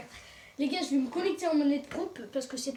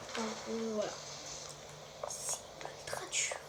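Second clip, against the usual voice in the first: whispering; 3 semitones lower, 9 dB quieter than usual. Real, not acted. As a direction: whisper it in defeat. Whispered complaint.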